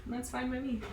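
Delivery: ordinary talk, nothing marked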